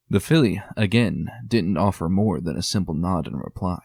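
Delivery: usual speaking voice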